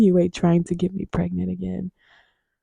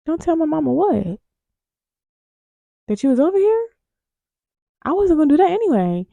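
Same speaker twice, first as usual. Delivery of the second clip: much higher, baffled disbelief, fast at the end; incredulous; 8 semitones higher, faster than usual. Real, not acted. Mocking a date's cringe line, incredulous and high. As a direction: react in total disbelief.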